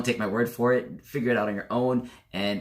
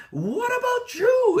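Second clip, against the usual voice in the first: drawn-out, high mock-angry yell; going at them; 5 dB louder, much slower than usual. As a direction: fire it back loud, hammed-up and very high. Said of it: "I'd just go at them".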